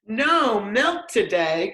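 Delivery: high, slow, dismayed cry; cried out. Acted. Farmer shocked by the cows' strike note, crying out high. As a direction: wail it in disbelief. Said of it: "cried Farmer Brown".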